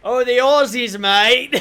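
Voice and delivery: loud, hammed-up Australian accent; mock Australian accent. Caster told to offend the Aussies, mock Australian accent. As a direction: belt out a laughing Aussie caricature. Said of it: "Why don't you just offend the Aussies".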